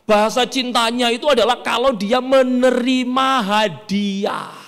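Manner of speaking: ordinary delivery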